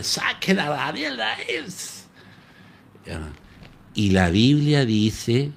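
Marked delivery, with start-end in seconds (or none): 0.0-1.5 high king's command; king commanding his men to pull Daniel out, in a high voice; king's order. Retold or imitated. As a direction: order the guards like a relieved king.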